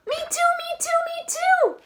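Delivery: high, loud little chick; a chick copying the duck, high and loud. Acted. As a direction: squeak it out eagerly like a tiny chick. Chick voiced in story.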